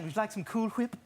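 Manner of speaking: "Whip" with a breathy H before the w; "Cool Whip" said with an overdone hw; exaggerated wh. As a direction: stress the H in "Whip", hwip. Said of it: "why are you saying it like that".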